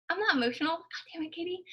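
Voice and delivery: fighting back real tears, barely getting words out; choking up. Real, not acted. Telling Katie off for making her cry, choked up and tearful. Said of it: "You're gonna make me cry".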